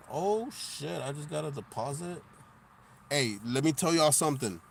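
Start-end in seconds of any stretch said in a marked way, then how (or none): none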